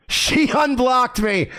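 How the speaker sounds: slow, high-pitched disbelief; amazed exclamation. Real, not acted. Streamer relishing being unblocked, amazed exclamation. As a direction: draw it out high with a laugh in it. Repeats his discovery in amazement.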